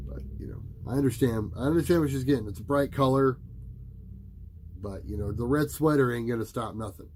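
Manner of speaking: usual speaking voice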